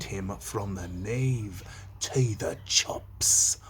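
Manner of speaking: hushed, slow whispered menace. Acted. Teacher whispering gory Shakespeare to class, hushed slow whisper. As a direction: breathe the gore out slowly. Reading gory Macbeth line.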